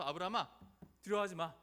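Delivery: loud, firm command; God's voice. Acted. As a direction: call out insistently to someone frightened. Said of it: "하나님께서 처음 찾아와서". (크고 단호하게)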